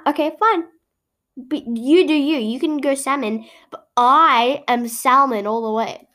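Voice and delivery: ordinary delivery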